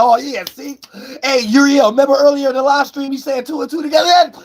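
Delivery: high, eager viewer voice; imitating excited viewer. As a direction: play the viewer catching on. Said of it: "you would've been like".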